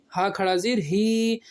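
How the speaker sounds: usual speaking voice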